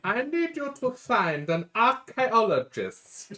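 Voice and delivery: high, slow, loud put-on quest-giver; drawn-out silly quest giver; mock NPC voice. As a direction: parody NPC asking a favor. Player mocks NPC quest.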